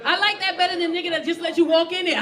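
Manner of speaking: ordinary talk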